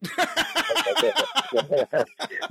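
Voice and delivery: amused chuckle; laughing. Real, not acted. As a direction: laugh it off warmly. Laughs after scolding dog.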